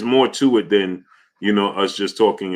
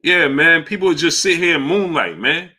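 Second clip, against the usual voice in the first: high exasperated complaint; 8 semitones higher than usual. Real, not acted. Complaining viewers only watch.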